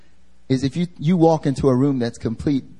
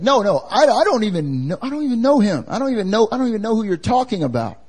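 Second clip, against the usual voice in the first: high, repetitive mock protest; flustered denial; 7 semitones higher, faster than usual. Peter denying Jesus to the crowd, flustered mock protest. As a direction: protest in a high voice, tripping over restarts. Peter denies again, acted by preacher.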